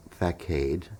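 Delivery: FACADE as "fack-AID"; spelling pronunciation. Retold or imitated. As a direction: say FACADE with a hard k, rhyming with arcade. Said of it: "Yes, it looks like facade".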